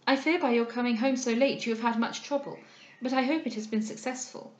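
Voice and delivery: plain reading voice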